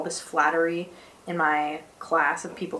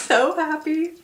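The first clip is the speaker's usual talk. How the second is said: so happy, voice lifts much higher; overjoyed; 10 semitones higher than usual. Real, not acted. Student gushing about a classmate's compliment, overjoyed. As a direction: let delight push the voice way up.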